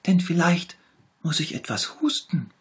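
high, pitiful little tiger voice; sick little tiger. A narrator's reading. Little tiger shows the bear where it hurts, high and pitiful. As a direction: whimper it, poor sick cub. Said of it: "sagte der kleine Tiger".

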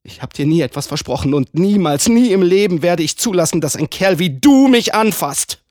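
lauter werdend, entschieden abweisend; scharfe Zurückweisung. Acted. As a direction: steigere dich in die Abwehr hinein. Frau weist ihn scharf zurück. (zunehmend laut und entschlossen)

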